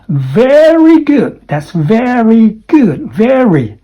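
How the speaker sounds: "very" with a strong, drawn-out R, high and slow; strong R sound. Shown as a demonstration. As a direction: stretch the R in "very". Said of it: "where is the R sound?".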